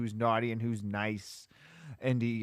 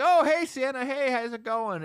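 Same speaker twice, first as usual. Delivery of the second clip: much higher and louder bright greeting; friendly churchgoers; 11 semitones higher, 5 dB louder than usual. Retold or imitated. Churchgoers leaving mass greet Santa, high and loud. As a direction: hail Santa like a happy crowd.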